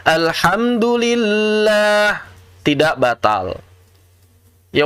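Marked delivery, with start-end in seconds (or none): 0.1-2.2 "Alhamdulillah" very slow, stretched on a level higher tone; overlong reading. Shown as a demonstration. Teacher imitates someone reading long, "Alhamdulillah" drawn out. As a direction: stretch out "Alhamdulillah", holding the final lah very long. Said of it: "Kalau ada orang baca panjang".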